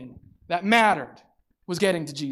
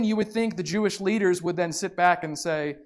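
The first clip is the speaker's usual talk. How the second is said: ordinary talk, nothing marked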